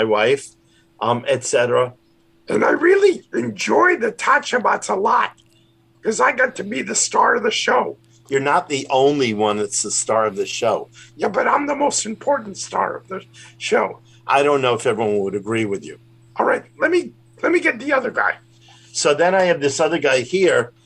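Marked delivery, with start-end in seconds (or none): 2.5-8.0 high puppet voice, boasting cheerfully; Grover puppet voice. Acted. Rabbi voices Grover puppet for the radio host, high and boastful. 11.2-13.9 high puppet voice, boasting cheerfully; Grover puppet voice. Acted. Rabbi voices Grover puppet for the radio host, high and boastful. 16.4-18.3 high puppet voice, boasting cheerfully; Grover puppet voice. Acted. Rabbi voices Grover puppet for the radio host, high and boastful.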